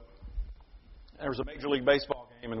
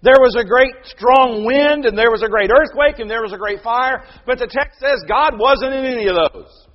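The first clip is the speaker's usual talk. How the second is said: loud, high, full-force sermon voice; fiery preaching; 9 semitones higher, 13 dB louder than usual. Acted. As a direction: drive every point home from the pulpit.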